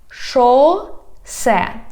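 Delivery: "Шосе" slow, in two separate syllables. Shown as a demonstration. Teacher teaching street words, "Шосе" slowly by syllables. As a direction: stretch "Шосе", pause between the syllables. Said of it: "divide it into syllables".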